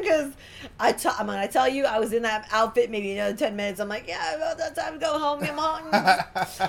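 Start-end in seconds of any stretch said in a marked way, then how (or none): 4.1-6.7 drawn-out mock whine about going home; whiny and tired. Pinned into her outfit, begging to go home: mock whine. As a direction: sulk like a tired kid who wants to leave. Fed up, wanting to leave.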